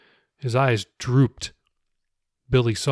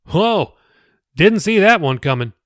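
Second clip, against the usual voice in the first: startled whoa, higher and louder; surprised; 6 semitones higher, 6 dB louder than usual. Acted. The pastor reacts to Jacob's news, surprised and louder. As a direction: caught off guard, eyebrows up.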